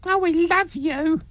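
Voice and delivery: high, slow, breathless gushing crowd; gushing fan. Retold or imitated. Host mocking cheering crowds, gushing high adoring coo. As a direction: coo like a flag-waving royal fan.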